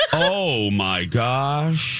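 ordinary delivery

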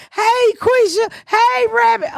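high, drawn-out hailing call; excited greeting. Retold or imitated. Old neighbors hugging and greeting the women, excited greeting. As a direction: sing out hello to old friends.

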